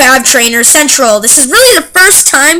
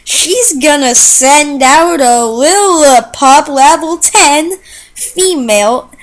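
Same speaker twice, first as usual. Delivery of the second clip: drawn-out slow speech; slow motion. Pretend. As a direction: talk slowly to match the laggy game. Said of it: "I'm gonna try and talk in slow motion so I can match".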